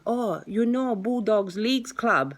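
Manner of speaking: high, drawn-out imitation Chinese accent; attempted Chinese accent. Car seller giving a landmark on the phone, attempted Chinese accent.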